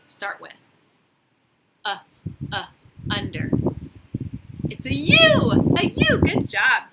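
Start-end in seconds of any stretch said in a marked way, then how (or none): 4.7-6.8 high bright praise; excited. Acted. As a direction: cheer the answer out.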